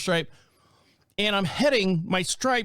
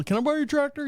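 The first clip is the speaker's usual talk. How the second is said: neighbor asking; 10 semitones higher, faster than usual. Retold or imitated. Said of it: "You know".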